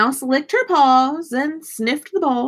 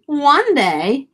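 ordinary delivery

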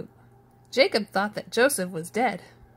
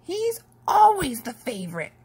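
higher and louder complaining brother; whiny sibling protest; a brother complaining loudly; complained; 7 semitones higher, 6 dB louder than usual. A narrator's reading. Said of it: "complained another".

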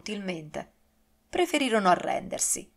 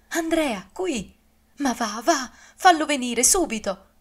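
high, urgent and pressing, louder by the end; agitated; 6 semitones higher, 5 dB louder than usual. A narrator's reading. Grieving duchess urging Maddalena to fetch Andrea, agitated and high. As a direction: hurry the servant out, voice climbing. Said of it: "Se vostra eccellenza si agita così".

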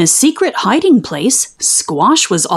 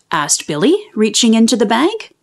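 ordinary delivery, nothing marked

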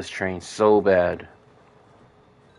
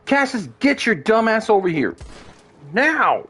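yelling command; 10 semitones higher than usual. Real, not acted. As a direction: bark it across the distance. Yelling at his horse.

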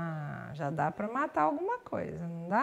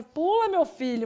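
conselho impaciente; 6 semitones higher, 4 dB louder than usual. Pretend. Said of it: "Dá vontade de falar assim".

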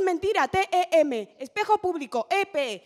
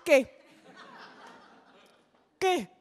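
ordinary talk

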